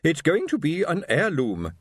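high, slow and superior; haughty matron, slow. A narrator's reading. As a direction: correct them with dignity. Wife voiced in story.

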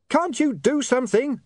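very high, strained yell; screamed plea. A narrator's reading. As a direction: plead over a deafening din. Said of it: "he screamed".

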